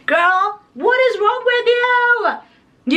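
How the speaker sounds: put-on high, slow, drawn-out indignant girl; mock outrage. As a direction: stretch it out in fake outrage. Mock outrage at gorgeous friend.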